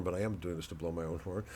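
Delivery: ordinary delivery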